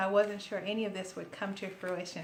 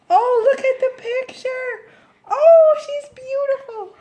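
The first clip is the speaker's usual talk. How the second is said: high, loud, delighted squeal; thrilled squeal; 15 dB louder than usual. Real, not acted. Sees the picture, thrilled.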